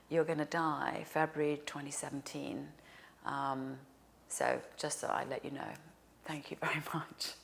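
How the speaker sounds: breathy secret whisper of a death prophecy; whispered warning. Retold or imitated. Dream stranger delivers death message.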